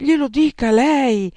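high, loud, slow imploring; pleading. Acted. Desperate youth begging Santippe to persuade Socrates. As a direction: beg her to make him listen.

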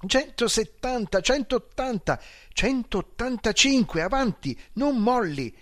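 high thrilled cheering; high shouts counting up the speed; excited shouting. Acted. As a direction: egg the driver on, shouting. Passenger cheering rising speed.

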